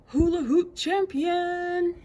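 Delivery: high slow proud voice; high drawn-out brag; Saturn boasting. Acted.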